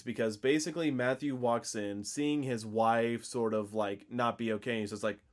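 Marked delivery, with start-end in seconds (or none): none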